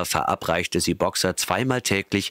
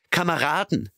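high, pleading call; 10 semitones higher than usual. A narrator's reading. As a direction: plead with the horses. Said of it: "riefen sie". (hoher, flehender Ruf)

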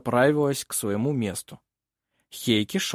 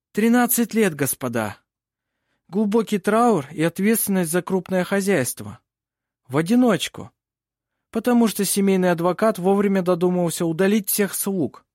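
higher, louder courtroom orator; advocate addressing court; 7 semitones higher, 4 dB louder than usual. A narrator's reading. Lawyer turns to the hall about the orphaned girl, declamatory. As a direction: project the facts to the whole hall.

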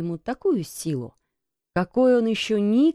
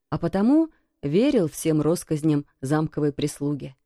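ordinary delivery

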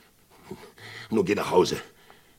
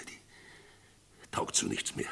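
slow, soft whisper; whispered trailing off; 12 semitones lower, 10 dB quieter, much slower than usual. Acted. Sheriff whispering to Tom that his gun is useless. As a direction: let it die away.

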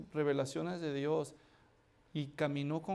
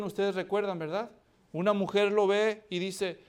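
ordinary talk, nothing marked

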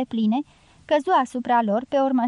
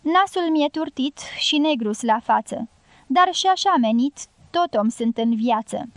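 usual speaking voice

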